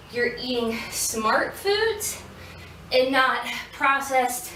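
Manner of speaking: drawn-out, deliberate advice; slow emphatic. Real, not acted. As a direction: lean on every word slowly.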